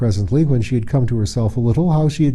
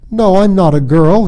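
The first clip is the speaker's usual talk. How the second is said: angry denial; 8 semitones higher, 8 dB louder than usual. Acted.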